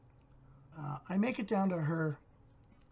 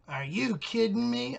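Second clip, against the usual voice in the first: put-on slow, louder disbelief as the girl; incredulous neighbor; 6 semitones higher, 6 dB louder, slower than usual. Man mimicking the neighbor's disbelief at his Trump vote, slow and loud. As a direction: drag it out in outraged disbelief.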